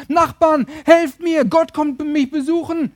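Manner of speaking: high, loud acted shout to neighbors; mock urgent cry for help; calling out for help. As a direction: call out the door for help. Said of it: "rief raus".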